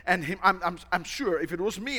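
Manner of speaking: normal talking voice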